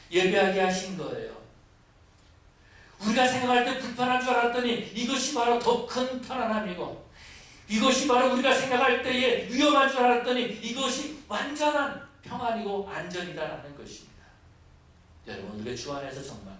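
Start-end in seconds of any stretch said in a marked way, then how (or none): 3.0-14.3 building, fervent sermon climax; fiery preaching. Acted. Pastor denounces the grumbling Israelites, fervent preaching. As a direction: drive the point home to the congregation.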